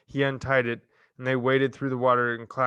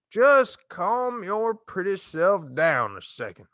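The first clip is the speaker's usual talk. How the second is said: slow, higher sleepy drawl; 8 semitones higher, 4 dB louder, slower than usual. Acted. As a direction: stretch every word out lazily. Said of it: "in a slow drawl".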